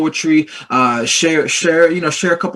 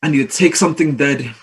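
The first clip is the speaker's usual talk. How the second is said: spoken word poem; 3 semitones higher than usual. Acted. Poet performs for a festival audience, building to defiant force. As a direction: drop back, then build to an impassioned declaration.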